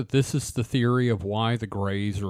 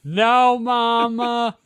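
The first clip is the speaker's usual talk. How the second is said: put-on whiny child; 9 semitones higher, 3 dB louder, much slower than usual. Child answers the mother.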